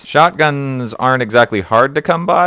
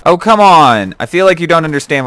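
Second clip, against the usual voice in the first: loud, high exasperated outburst; frustrated cry, higher and louder; 8 semitones higher, 6 dB louder than usual. Real, not acted. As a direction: protest a rotten bit of luck. Frustrated at a missed chance.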